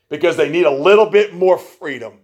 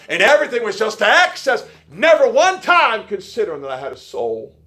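rising preacher fervor; 7 semitones higher than usual. Real, not acted. Preacher building to a climax.

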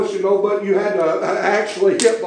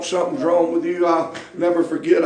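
usual speaking voice

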